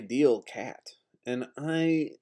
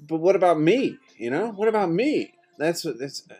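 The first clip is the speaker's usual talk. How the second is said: rising plea; 9 semitones higher than usual. Real, not acted. Lonely speaker demanding the world notice him, rising to a high plea. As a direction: demand to be seen, building and building. Building self-pity rant.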